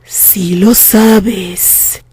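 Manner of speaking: very slow, calm threat; menacing. Acted. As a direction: let each word hang, ominous. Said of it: "La voz femenina sonó amenazadora".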